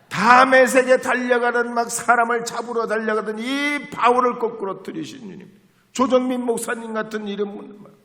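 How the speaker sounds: loud, high fiery preaching; preaching at full force. Acted. Preacher telling the congregation of the wild dawn prayer, fiery preaching. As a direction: pour it out like a revival preacher.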